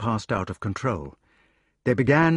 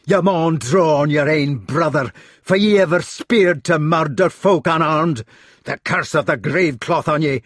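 Scots tirade building louder and higher; roaring rage; 4 semitones higher, 4 dB louder than usual. A narrator's reading. Gawain advances on his sword-drawing brother, roaring. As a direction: rage at him in broad Scottish, building. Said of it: "roared Gawain".

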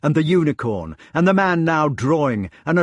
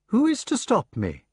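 high, cool challenge; icy; 9 semitones higher than usual. A narrator's reading. Humpback challenges Gawain blocking his path, icy. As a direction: challenge him without raising your volume. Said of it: "remarked the icy voice".

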